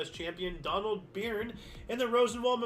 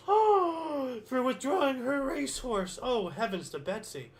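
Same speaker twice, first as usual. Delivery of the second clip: mock shock; 6 semitones higher, 3 dB louder, slower than usual.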